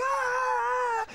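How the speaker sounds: put-on high loud yelp of a hit kid; pained yelp. Boy knocked to the ground by a punch, loud high yelp. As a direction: yelp like you just got knocked down. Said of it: "and he's like".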